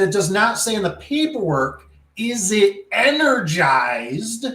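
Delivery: high, drawn-out stress on "energized"; exasperated emphasis. Retold or imitated. Arguing paperwork wording with manager, "energized" said high and very slowly. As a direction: pitch it up and stretch "energized" slowly to make the point.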